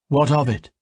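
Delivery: sharp, defiant challenge; mildly defiant elf woman. A narrator's reading. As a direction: throw it back at him. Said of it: "Kalia asked defiantly".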